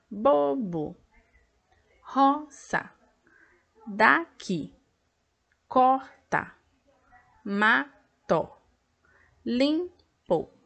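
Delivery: each word said in two separate, clear beats; slow classroom syllable counting, two beats per word; split into two syllables. Shown as a demonstration. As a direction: open the mouth twice per word, syllable by syllable. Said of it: "eu vou abrir a boca duas vezes".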